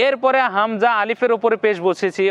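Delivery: ordinary talk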